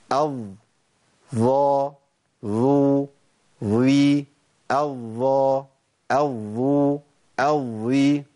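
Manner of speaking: الض ظا ظو ظي الضا الظو الظي, tongue tip between the teeth, vowels stretched long; ظاء demo. Shown as a demonstration. Quran teacher drills the seven ظاء cases for students, stretched slowly. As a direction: drill each ظاء case slowly and clearly. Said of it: "هعيدهم تاني".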